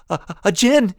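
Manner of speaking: stammering, high, loud fear; rising in fear. A narrator's reading. Young soldier crying out in fear. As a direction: terror climbing up. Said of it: "his voice rising in fear".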